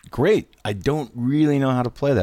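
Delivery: high, forced "great"; nervous false cheer. Retold or imitated. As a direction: fake confidence, then admit it. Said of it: "And I go".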